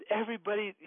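high, mock-upset little girl; retold crying child. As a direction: lightly imitate a tearful child, pitched high. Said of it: "and she's crying".

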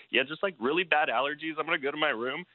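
high, put-on choked-up excuse; tearful cover-up. Crying man excusing himself to his buddy, imitated tearful.

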